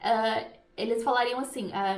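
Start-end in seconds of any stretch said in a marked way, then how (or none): none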